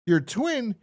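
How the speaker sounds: high squeaky surprise; mock surprise. The killer hearing about the twin, in high squeaky surprise. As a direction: squeak it out in disbelief. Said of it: "killer surprised".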